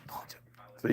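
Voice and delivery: mock whisper to his friends; whispered aside. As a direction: whisper it on the side, conspiratorial. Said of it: "he looks over at the guys and like".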